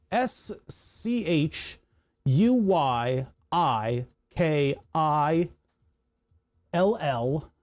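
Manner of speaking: letters spelled out slowly; slow spelling. Shown as a demonstration. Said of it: "spelling the name".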